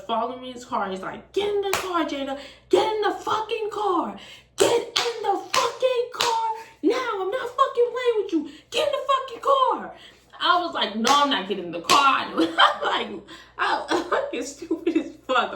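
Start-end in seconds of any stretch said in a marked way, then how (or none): 1.3-9.9 very high, insistent mock yelling; angry ordering. Boyfriend orders her into car. 11.0-15.5 raised voice breaking into laughter; laughing. Real, not acted. Laughing at her own retelling.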